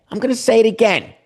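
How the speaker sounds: \"I'm gonna say it again\" pitched high and quick; insistent high-pitched repeat; emphatic repeat. Shown as a demonstration.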